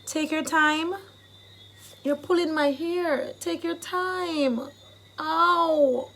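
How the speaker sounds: acted ouch, drawn out; pained complaint. Shown as a demonstration. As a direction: complain as your hair gets pulled. Acting out having hair pulled.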